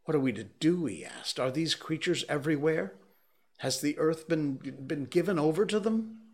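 high, loud fearful questions; panicked questions. A narrator's reading. Curate begging the narrator for answers, frightened and high. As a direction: plead in rising fear.

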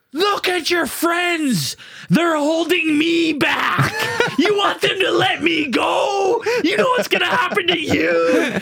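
high, drawn-out taunting yell; acted yelling. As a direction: imitate an enraged man being held back. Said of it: "and he yells".

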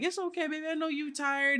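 put-on high, gentle soothing to a tired baby; soothing baby talk. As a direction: hush her like she's in your arms. Soothing crying baby in story.

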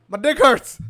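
high, loud, hurting cry; pained yelp. Retold or imitated. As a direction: wail it like it really hurts. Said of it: "what I was translating it to".

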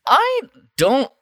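usual speaking voice

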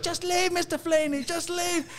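very high, squealed put-on voice; imitated pleading staff. Hospital staff sending the patient away, very high imitation. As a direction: squeal it as a mock plea. Voicing staff telling him to leave.

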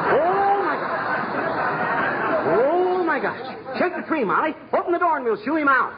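high-pitched panic; flustered alarm. Acted. Husband organizing the owl's removal, flustered. Panics over owl in the house.

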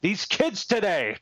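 put-on grumpy old man. Catching himself sounding old, a hint of a grumpy old man. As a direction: grumble it like a crotchety old man. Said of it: "I caught myself saying".

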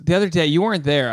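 ordinary talk

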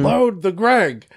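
drawn-out order to a catapult crew; Dan Coates. Acted. Teller voicing Dan Coates ordering Greg loaded, mock command. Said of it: "he's like".

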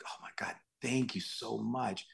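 hushed, slow, near-whispered gratitude; relieved thanks. Retold or imitated. Actor thanks co-star for rescuing him, hushed relief. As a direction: breathe out the thanks softly and slowly.

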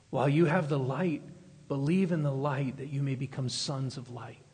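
soft, low-key appeal; gentle, meek pleading. Retold or imitated. Preacher voicing Jesus pleading with the crowd, gently and meekly. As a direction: plead quietly, without pressing. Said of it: "he's gently, meekly pleading with them".

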